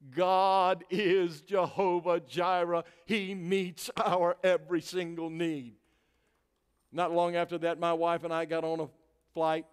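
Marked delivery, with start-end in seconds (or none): 0.1-5.8 slow, loud, drawn-out proclamation; sermon climax, sustained and emphatic; preaching declaration. Real, not acted. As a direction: proclaim it with every word held long. Preaches praise at full force.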